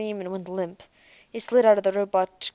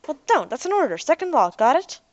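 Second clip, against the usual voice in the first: a higher, louder commanding order; stern demand; 7 semitones higher, 6 dB louder than usual. Acted. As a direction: give the robot an order, firm. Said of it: "Jeff demanded".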